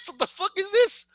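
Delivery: high-pitched comic disbelief; incredulous bafflement. Retold or imitated. Viewer hearing the secret love line, high baffled disbelief. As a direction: voice jumps up, utterly thrown.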